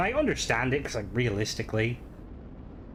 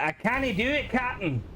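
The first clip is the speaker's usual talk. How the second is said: higher-pitched, hammed-up Scottish voice-actor accent; mock Scottish accent; 12 semitones higher than usual. Mocking how game voice actors do a Scottish officer, in a higher, hammed-up Scottish accent. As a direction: send up a game's stock Scottish accent. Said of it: "It's always like the".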